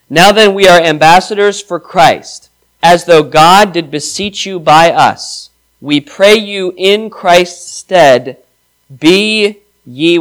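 slow, weighty reading of scripture. Acted. Preacher reads the verse to the congregation, slow and weighty. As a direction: lean on each word like holy text.

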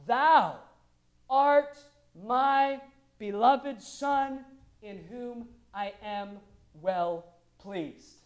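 very slow, booming declaration easing off; loud, stately divine pronouncement; voice of God the Father. Acted. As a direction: proclaim each word grandly, drawn out. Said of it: "gives us the direct quote of God the Father".

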